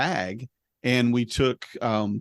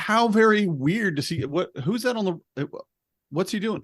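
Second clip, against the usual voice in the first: higher-pitched onlooker voice; mock-suspicious neighborhood watcher; puzzled neighbors; 6 semitones higher than usual. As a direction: wonder aloud like a nosy neighbor.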